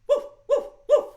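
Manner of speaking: high, loud, short woofs; three separate yappy dog barks; three hound dog barks. Pretend. Dog asking children to join the song, barking. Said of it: "give me three hound dog barks".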